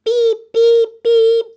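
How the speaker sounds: high, loud beep beep beep; urgent beeping. A narrator's reading. Stuck little truck calls everyone, loud high beeps. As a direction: beep for help as loud as you can. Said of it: "Everybody heard that".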